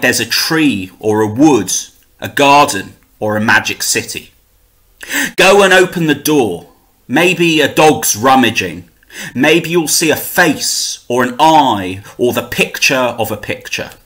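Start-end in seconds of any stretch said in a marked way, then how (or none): none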